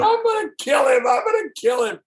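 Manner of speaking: high, imitated furious holler; Dutch enraged. Driver imitating his passenger raging at the culprit, outraged.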